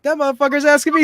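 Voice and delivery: furious that the guy hit him up too; indignant shout. Retold or imitated. Coworker outraged at the scammer.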